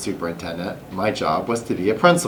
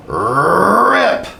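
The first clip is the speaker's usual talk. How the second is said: ripping sound; 9 semitones higher, 5 dB louder than usual. A narrator's reading. Costume tearing.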